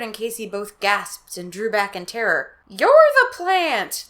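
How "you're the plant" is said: high, loud, very slow accusation; accusing. Acted. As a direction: spit the accusation back.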